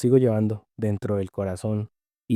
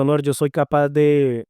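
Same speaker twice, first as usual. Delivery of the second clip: louder, higher, slowed plea to God; a retold prayer, raised and slow; fervent prayer; 5 semitones higher, 5 dB louder than usual. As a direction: cry out to the Lord, drawing each word out. Retelling his earnest prayer to God.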